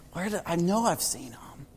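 hushed, puzzled whisper to himself; whispered inner thought. Retold or imitated. Pastor puzzling over the couple's faces, whispering to himself. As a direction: whisper it under your breath, trying to place their faces.

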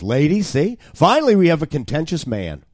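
high, loud playful announcement; mock triumph. Preacher to the ladies on finding a contentious man, mock-triumphant. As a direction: crow it to the women as a long-awaited win. Teases the women in congregation.

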